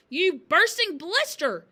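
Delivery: slow, high, furious yell. A narrator's reading. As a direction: stretch out the insult at full volume. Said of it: "yelled the Trunchbull".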